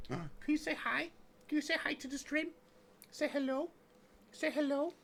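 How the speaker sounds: very high, soft pet-talk voice; squeaky soft voice for the puppy; baby talk to dog. Acted. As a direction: coo at the dog to say hi. Coaxing the dog to greet stream.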